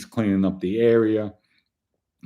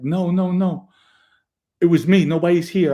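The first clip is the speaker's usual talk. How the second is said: higher, urgent no no no; retold panicked friend; 8 semitones higher, 4 dB louder than usual. Wounded friend insists nobody else was here, higher and louder. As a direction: rush to stop his friend panicking. Voices Matthew's panicked denial.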